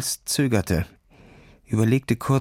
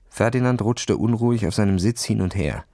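ordinary delivery

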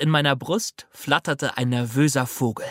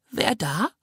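put-on old lady voice; 7 semitones higher than usual. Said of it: "fragte die Gestalt".

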